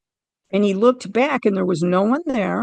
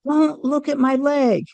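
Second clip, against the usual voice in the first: yell; 7 semitones higher than usual. Retold or imitated. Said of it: "I yell".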